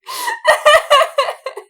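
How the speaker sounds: a burst of high, loud laughter; big laugh. Real, not acted. Fangirl laughing over Obi.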